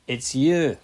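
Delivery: "you" punched high and loud; stress on YOU. Shown as a demonstration. Preacher stressing whom the psalm addresses, emphatic YOU. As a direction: hit "you" with a strong rise and fall. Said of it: "the emphasis makes it the focus".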